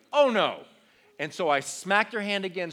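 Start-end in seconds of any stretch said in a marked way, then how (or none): 0.1-0.6 shocked. Retold or imitated. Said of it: "And I'm like".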